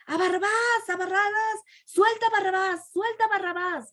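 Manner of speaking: high, chanting yell of an angry mob; crowd shouting. Acted. The crowd yelling at Pilate to free Barabbas, high chanted shouting. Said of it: "Y empezaron a gritar".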